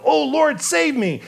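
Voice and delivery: high, desperate cry to the Lord. Retold or imitated. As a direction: Peter sinking, crying out for help. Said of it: "Peter cries out".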